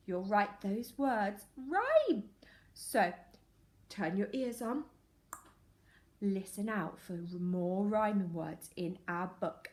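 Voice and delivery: plain reading voice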